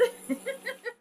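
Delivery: high, light giggle; teasing laugh. Real, not acted. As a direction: giggle after the tease. Laughs after teasing Peter.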